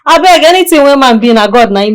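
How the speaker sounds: high, loud exasperated plea; emphatic protest. Acted. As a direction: protest it loudly, pitch jumping up. Friend protests against the accusation.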